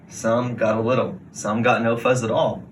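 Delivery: ordinary delivery, nothing marked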